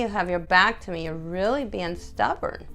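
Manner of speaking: ordinary talk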